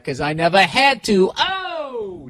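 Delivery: rising boast into a long high "oh"; cocky mock brag, drawn out; mock bragging. Mock bragging, then just kidding.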